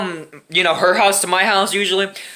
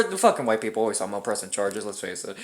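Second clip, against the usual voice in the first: muttered aside; 13 semitones lower, 10 dB quieter than usual. Real, not acted.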